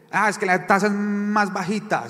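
high-pitched, wheedling excuse; acted excuse. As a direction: play someone talking themselves into a temptation. Voicing another excuse to borrow.

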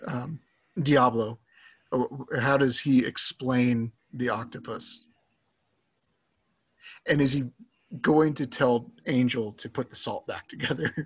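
usual speaking voice

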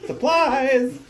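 high, loud exclamation; shouted. Retold or imitated. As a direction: yell it like a surprise. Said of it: "thought he said".

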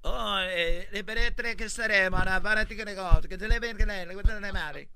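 gibberish posing as church Latin; mock Latin. Priest murmuring over the baby at baptism, mock Latin. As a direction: babble like a priest chanting bad Latin. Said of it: "Soy así como que habla latín".

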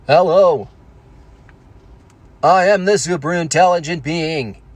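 booming-greeting robot voice, stiff and measured; Stephen Hawking. Acted. Giant hologram in the sky addressing people, robotic synthesizer impression. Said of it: "Stephen Hawking hologram projection in the sky".

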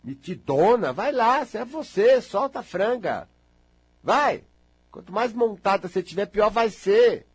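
imitating a friend urging her on; mock cheering on. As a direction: push her to let loose. Urging someone to let loose.

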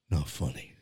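put-on low, quiet, unamused kid; flatly unimpressed son, low and soft; son deadpan. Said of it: "he just went".

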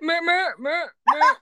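high-pitched Japanese goat bleats; quick repeated bleats, mock Japanese goat; Japanese goat accent. Shown as a demonstration. Answering a friend's request, high Japanese goat bleat. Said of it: "Sa Japanese".

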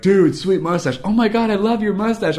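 high-pitched imitation of excited strangers; hammed-up fan enthusiasm; mock gushing. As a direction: gush like an admiring stranger. Strangers gushing over the mustache.

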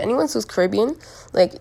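ordinary delivery

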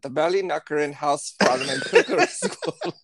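Irish lilt cracking into a laugh; laughing Irish accent. Retold or imitated. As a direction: try the Irish accent but crack up halfway.

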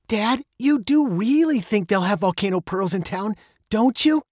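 put-on high-pitched girl questioning her dad; hopeful daughter. A narrator's reading. Veronica asks her dad about volcano pearls, high and hopeful. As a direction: plead lightly, lifting into the tag question. Girl seeks dad's reassurance.

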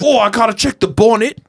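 high mock British accent; cheerful Brit fussing over his car.

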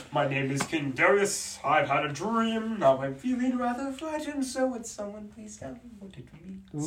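lightly voiced cartoon king; a hint of a nervous king. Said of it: "an old sketch from VeggieTales".

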